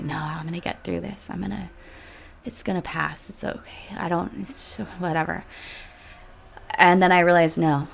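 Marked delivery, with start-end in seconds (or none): 0.0-5.4 much softer, half whispered, brushing it off; quiet self-talk. Retold or imitated. Telling herself she'll get through it, hushed. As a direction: mutter it to yourself, dismissive. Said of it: "I was like".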